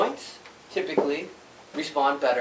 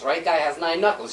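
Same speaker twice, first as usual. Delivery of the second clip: ordinary talk, nothing marked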